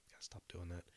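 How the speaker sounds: whispered under the breath; whispered aside. Real, not acted. Narrator muttering to himself mid-story, whispering. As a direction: drop to a whisper.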